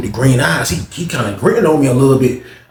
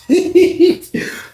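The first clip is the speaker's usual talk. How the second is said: high-pitched burst of laughter; high giggle. Real, not acted. Storyteller cracking up at his own pickup line, giggling.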